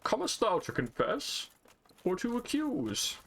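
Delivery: put-on high, theatrical old-timey voice; character voice. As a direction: play a pompous priest. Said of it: "That's kind of his whole shtick".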